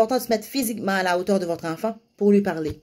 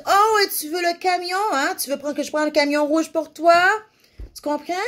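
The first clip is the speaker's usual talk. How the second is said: much higher and louder, bright encouraging coo; eager parentese; 10 semitones higher, 3 dB louder than usual. Shown as a demonstration. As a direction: cheer the toddler on as you echo the word. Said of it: "Tu vas dire".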